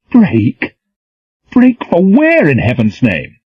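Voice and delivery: high, loud disbelief; incredulous. Acted. Reynolds in disbelief.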